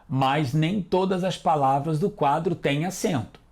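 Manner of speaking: ordinary talk, nothing marked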